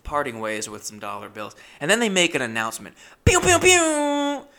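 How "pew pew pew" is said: high, slow mock laser pew-pews; loud sci-fi blaster noises; laser sound effect. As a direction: zap it out like a cheesy laser. Mimicking announcement sound effect.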